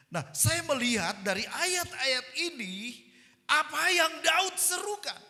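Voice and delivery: high, loud, urgent sermon voice; full-force preaching. Real, not acted. Preacher to congregation on life's hard struggles, full-force preaching. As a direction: proclaim it at the top of the voice.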